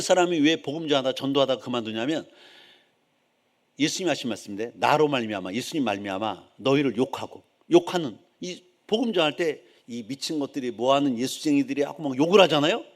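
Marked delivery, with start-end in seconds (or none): none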